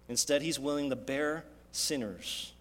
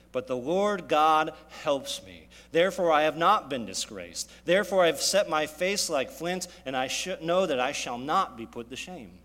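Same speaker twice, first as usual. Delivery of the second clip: preaching at full force; 7 dB louder than usual. Acted. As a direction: proclaim it like flint, firm and loud. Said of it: "what does it say".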